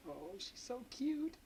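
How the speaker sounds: high, slow, soft doting voice; cooing. Real, not acted. Filming toddler in yard.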